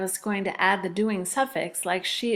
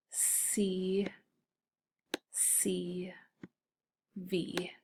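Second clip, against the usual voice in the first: whispered letters; 10 dB quieter, much slower than usual. Shown as a demonstration. Teacher whispering letters to second graders. Labeling vowels and consonants.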